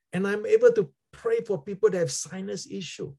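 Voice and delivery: ordinary delivery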